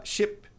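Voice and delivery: "ship" with a short, quick i. Shown as a demonstration. Teacher contrasts "ship" with "sheep" for students. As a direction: contrast "ship" with a clipped i sound. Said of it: "você tá falando navio".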